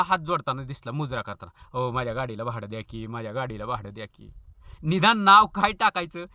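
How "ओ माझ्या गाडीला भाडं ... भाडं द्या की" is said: mock pleading plea. Pleading slogan voiced low, soft.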